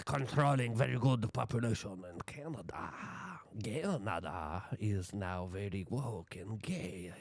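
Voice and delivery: slow, smug mock German accent; mocking a smug German elite; Klaus Schwab impression. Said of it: "He was like".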